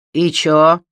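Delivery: громкий хамоватый бандит; бросить с вызовом; грубый бандит. Acted. Колян отвечает. (хамское «и чё»)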